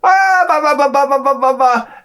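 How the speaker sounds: high gibberish babble; mock foreign babble. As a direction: mimic chatter you can't follow. Mimics wife's foreign chatter.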